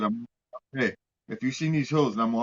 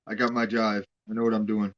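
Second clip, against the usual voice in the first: ordinary talk, nothing marked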